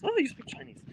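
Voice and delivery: high, surprised gasp of a line; a local man shocked a foreigner speaks Chinese. Retold or imitated. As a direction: act amazed he can speak it. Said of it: "He was very shocked".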